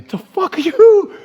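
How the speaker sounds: very high, loud drawn-out cry; retold outburst. Tattooed man reacting to his bloody shirt, outraged yell. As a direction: stretch it into a mock yell.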